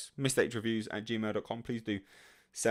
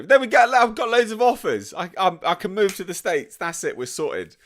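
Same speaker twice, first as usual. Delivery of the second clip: much higher and louder, gleeful bragging; mock triumphant; 9 semitones higher, 9 dB louder than usual. To the chat about marriage offers, mock gleeful triumph. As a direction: crow about your offers like you've won.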